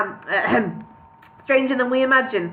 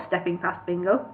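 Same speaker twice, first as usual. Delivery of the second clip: usual speaking voice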